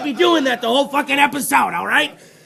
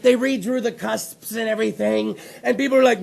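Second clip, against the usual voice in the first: ordinary talk, nothing marked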